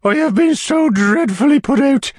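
high, fluttery widow voice; fussy widow voice. A narrator's reading. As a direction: play the flustered widow, pitched high. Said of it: "cried the lady".

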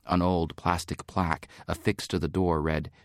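ordinary delivery

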